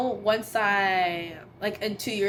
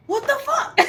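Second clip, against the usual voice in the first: high, loud, laughing outburst; mock dad yelling in disbelief; dad's outraged shock; 5 dB louder than usual. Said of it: "He's like".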